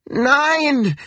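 high, wailing cry of refusal; anguished high-pitched wail; desperate cry. A narrator's reading. Woman resists being put off boat.

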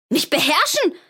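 high, loud incredulous cry; a furious girl yelling at her father; indignant shout. Acted. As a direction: hurl his words back at him. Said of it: "schrie Fern".

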